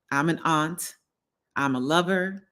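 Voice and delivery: usual speaking voice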